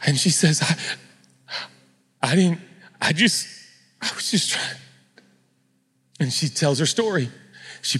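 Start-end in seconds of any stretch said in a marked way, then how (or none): 0.6-4.8 halting, timid stammer that fades away; trembling. Acted. Trembling woman trying to explain herself, stammering fearfully. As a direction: start high, falter and trail into breath. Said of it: "she comes out trembling".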